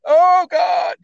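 imitating a friend who starts crying; hammed-up sob of despair; mock crying. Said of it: "he starts crying".

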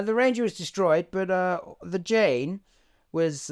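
ordinary talk, nothing marked

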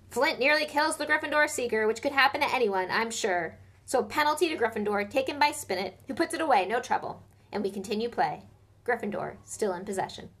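loud, quick play-by-play; live commentary. A narrator's reading. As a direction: commentate the match excitedly.

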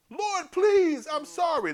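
high, loud pleading cry; drowning people crying out to God; mock desperate plea. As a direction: beg for mercy.

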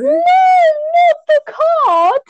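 high drawn-out wail; dismayed shout. Real, not acted. Dismay over cut card.

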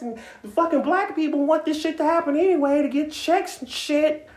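high, far-off mock Karen rant; Karen Bob voice. Mocking entitled protesters in a Karen voice. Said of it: "That's my Karen Bob voice".

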